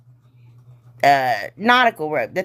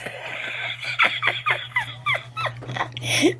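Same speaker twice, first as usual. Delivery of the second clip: silent wheezing laugh, breath only; breathless high laugh; 8 dB quieter than usual. Real, not acted. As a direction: laugh soundlessly at your own silliness. Laughs at own folding.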